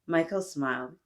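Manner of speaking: ordinary delivery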